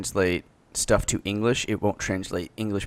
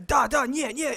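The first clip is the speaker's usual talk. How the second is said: high-pitched mock Russian "da, da"; squeaky hammed-up Russian; 13 semitones higher than usual. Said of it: "he's like".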